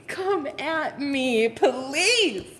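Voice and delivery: high, stretched-out dare; slow, sassy come-and-get-me; confident taunt. Acted. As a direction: drag out every word as a cocky challenge. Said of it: "You have this level of confidence".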